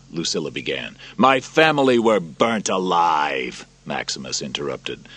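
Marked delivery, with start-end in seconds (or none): 1.2-3.6 slow, loud, hurled at her; grieving soldier's fury; angry accusation. Acted. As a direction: throw the words at her. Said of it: "throwing the words at her in anger".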